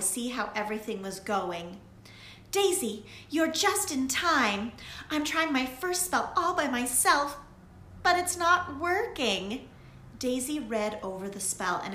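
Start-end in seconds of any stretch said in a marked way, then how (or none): 2.5-9.7 put-on higher girlish voice, louder, anxious; a little witch rushing to her friend for help; young witch Lily, flustered. A narrator's reading. Lily telling Daisy the spell fails, flustered.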